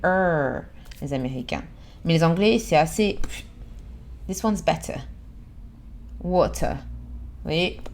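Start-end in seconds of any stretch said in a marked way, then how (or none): 0.0-0.6 drawn-out American "er"; insistent American r. Shown as a demonstration. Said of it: "ils vont insister sur le". 4.3-6.8 "better" and "Water" stopping short, no final r; English clipped ending. Shown as a demonstration. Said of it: "Ça s'arrête".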